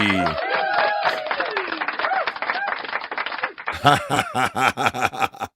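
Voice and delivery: long, high-pitched burst of laughter; big laugh. Real, not acted. Host cracks up at a viewer's comment, long high laugh. Host reacts to a chat message.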